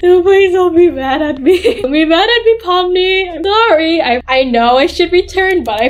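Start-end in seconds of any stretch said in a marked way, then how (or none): 0.0-4.0 high, hammed-up begging; over-the-top sorry, pitched way up; playful pleading. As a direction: beg in a mock-whiny high voice. Pleading with the character Pomni.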